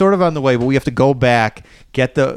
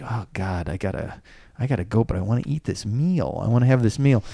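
hushed anxious muttering under his breath; half-whispered worry, as if to himself; 7 dB quieter than usual. Retold or imitated. Panicked inner thought, time crunch.

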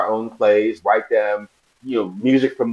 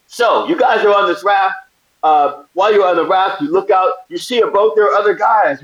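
higher, eager producer voice; producer explaining the stunt; 8 semitones higher, 4 dB louder than usual. Retold or imitated. Producer briefing the actor on the raft scene, eager and raised. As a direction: pitch it up like a keen crew guy laying out the plan. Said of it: "They go".

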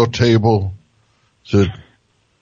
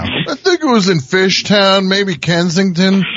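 higher, mocking imitation of a local; 9 semitones higher than usual. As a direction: parrot him in a high teasing voice. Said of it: "listen to this local talking".

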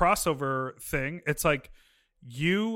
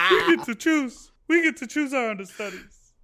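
laughing; 8 semitones higher, 4 dB louder than usual. Real, not acted. Joke lands, host laughing.

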